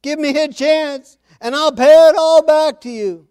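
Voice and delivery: high, loud pleading, same words; begging servant again. Acted. Fellow servant pleading with the one who throttles him, high and loud. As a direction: beg for mercy the same way. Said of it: "Same words".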